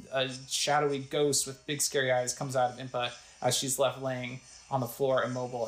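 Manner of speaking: ordinary talk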